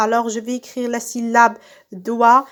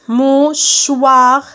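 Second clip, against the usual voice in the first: Mouchoir loud and drawn out, in separate beats; syllable by syllable; 6 dB louder than usual. Shown as a demonstration. Teacher cutting words into syllables, syllable by syllable. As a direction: stretch Mouchoir as MOU, then CHOIR. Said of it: "je découpe les mots en syllabes".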